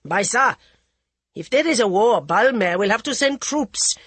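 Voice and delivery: a father cutting her short; stern father. A narrator's reading. The Maharaja stops his daughter's plans, stern and firm. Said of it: "The Maharaja's stern voice".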